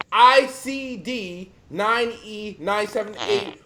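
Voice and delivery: slow, every letter separate; slow repeat dictation. Shown as a demonstration. Repeating the code for listeners, slowly. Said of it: "Once again".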